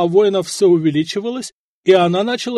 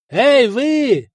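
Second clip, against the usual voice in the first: high, loud hailing yell; yelling from a tower; 8 semitones higher, 6 dB louder than usual. A narrator's reading. Commander on the tower hailing the enemy camp, yelling. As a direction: holler down to a crowd far below. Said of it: "заорал Вышата с башни".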